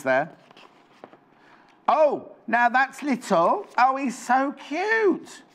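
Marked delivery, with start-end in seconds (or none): 1.9-5.4 slow, high-pitched gushing over something cute; cooing delight. Real, not acted. Presenter gushing over a little bear pattern, cooing. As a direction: coo it, drawn out and high.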